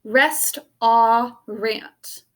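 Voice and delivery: "Rest, ah, rant" as three separate beats; dictation-style "Rest, ah, rant"; sounded-out syllables. Shown as a demonstration. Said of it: "Sound it out as you write".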